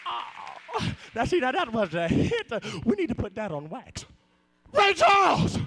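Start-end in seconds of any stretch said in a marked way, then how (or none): 4.7-5.5 loud, high cry of delight; thrilled yell of a fan; excited shout. Acted. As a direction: shout his name like a starstruck fan.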